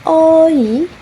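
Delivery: "oi" held long, high and loud; demonstrated diphthong. Shown as a demonstration. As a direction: stretch "oi" from aw into ee. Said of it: "for the last diphthong".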